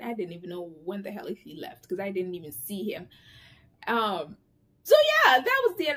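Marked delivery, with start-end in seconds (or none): 4.8-6.0 excited. Real, not acted. Wrapping up with a squeal.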